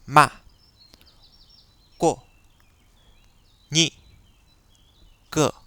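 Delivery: «ma, ko, ni, que» au quatrième ton, chute sèche et brève; sharp short falling fourth tone on ma, ko, ni, que; quatrième ton chinois. Shown as a demonstration. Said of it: "qui descend et est très sec, très court". (quatrième ton, sec et court)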